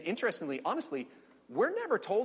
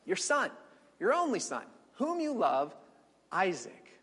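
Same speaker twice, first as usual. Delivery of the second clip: higher with each phrase, slowing to Isaac; God naming Isaac step by step; building description; 5 semitones higher than usual. Acted. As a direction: lay it on phrase by phrase. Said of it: "God doesn't do it that way".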